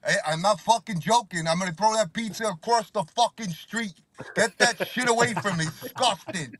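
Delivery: high-pitched mock outrage at offending food; disgusted Italian elder. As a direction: play an appalled older Italian relative. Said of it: "You're like".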